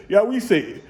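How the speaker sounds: ordinary talk, nothing marked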